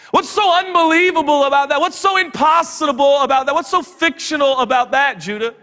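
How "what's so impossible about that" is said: ordinary talk, nothing marked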